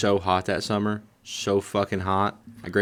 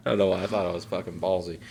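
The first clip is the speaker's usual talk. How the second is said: usual speaking voice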